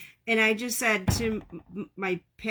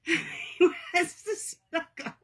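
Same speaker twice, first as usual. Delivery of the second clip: high slow laughing coo; 7 semitones higher, slower than usual. Real, not acted. Cat wants to snuggle.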